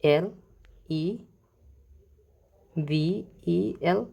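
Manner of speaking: ভি ই এল said one letter at a time, very slow; spelling out letters. Shown as a demonstration. Teacher spelling level backwards for the class, slowly. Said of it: "দেখেন উচ্চারণ করেন level".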